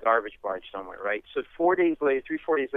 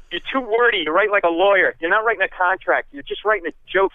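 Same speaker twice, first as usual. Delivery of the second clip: high, loud teasing critique; Leno scolding; 7 semitones higher, 6 dB louder than usual. Retold or imitated. Leno scolding a wordy lawyer, high, loud. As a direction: rib him like Leno, loud and up. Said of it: "He goes".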